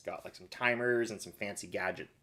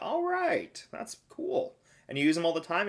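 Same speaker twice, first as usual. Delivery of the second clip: high mock voice; 9 semitones higher, 4 dB louder than usual. Asking son, acted quote.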